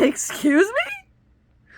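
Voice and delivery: affronted, daring her to repeat it; offended excuse me. Retold or imitated. To the caseworker who says she needs help, a slow, high, indignant excuse me. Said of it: "And I said".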